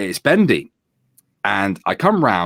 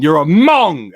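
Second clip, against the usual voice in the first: raised, higher-pitched jeer; loud parting shot; retold shouted insult; 7 semitones higher, 7 dB louder, slower than usual. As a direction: hurl it loudly at a driver in passing.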